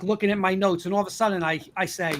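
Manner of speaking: normal talking voice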